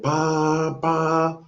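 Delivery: high, nasal-free mock trumpet call pam pam; trumpet blast imitation. Telling of angels blowing trumpets, imitating the trumpet blast. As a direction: blare it like a heavenly horn.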